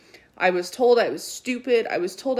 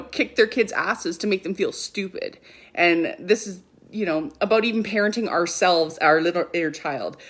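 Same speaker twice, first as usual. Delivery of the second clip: ordinary delivery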